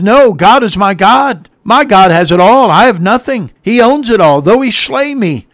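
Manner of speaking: loud, high preacher's proclamation as Job; impassioned declaration. Acted. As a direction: proclaim unshakable faith with rising pitch. Acts Job's defiant declaration of faith.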